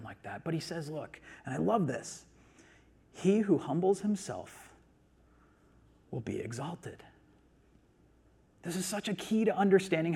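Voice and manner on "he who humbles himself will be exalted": slow scripture reading with a long pause, dropping soft at the end; measured, hushed close on the verse; slow, deliberate quoting. Shown as a demonstration. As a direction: let each phrase land, then pause. Scripture quote, slow first reading.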